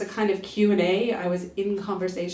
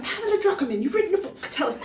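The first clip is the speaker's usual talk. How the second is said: pitched-up breezy interviewer voice; mock interviewer; 10 semitones higher, faster than usual. Asking herself like the radio host, high and mocking. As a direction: echo the clueless host, grandly. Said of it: "so I want to ask myself".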